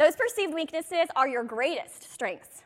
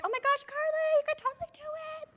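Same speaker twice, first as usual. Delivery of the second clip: much higher gushing cheerleader voice; imitating Jackie; 13 semitones higher, 3 dB quieter, faster than usual. She imitates her mentor Jackie gushing encouragement, much higher. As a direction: gush praise like an eager mentor. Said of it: "waited for Jackie to be like".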